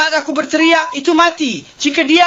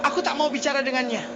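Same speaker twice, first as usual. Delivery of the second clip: much softer, quick sulky mutter; muttered refusal; 2 semitones lower, 10 dB quieter, faster than usual. Real, not acted. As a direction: mumble it fast under your breath. Refusing to talk to officer.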